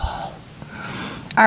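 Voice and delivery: whisper. Real, not acted. Whisper continues after remark.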